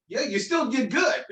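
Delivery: put-on very high child voice, amused; young son. Son consoling dad after lost election, high child voice. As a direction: imitate a little boy's encouragement.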